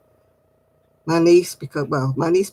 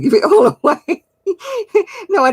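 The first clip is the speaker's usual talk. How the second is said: laughing; 7 dB louder than usual. Real, not acted. Joking about giving yarn away.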